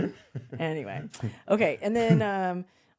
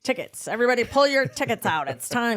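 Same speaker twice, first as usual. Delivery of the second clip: higher, loud conductor calling out; train conductor call; 5 semitones higher, 5 dB louder than usual. Retold or imitated. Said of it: "walking around going".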